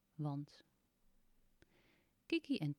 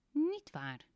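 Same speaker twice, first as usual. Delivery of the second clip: little man's voice; 9 semitones higher, 5 dB louder than usual. Acted. Little man contradicts Teun.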